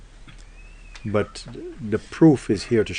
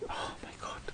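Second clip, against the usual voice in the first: breathless whispered "Oh my God"; frightened guest whispering at the cupboard; whispered gasp; 20 dB quieter than usual. Acted. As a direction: whisper it in shock. Guest sees the figure again.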